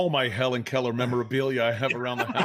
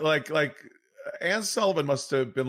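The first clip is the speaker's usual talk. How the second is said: ordinary talk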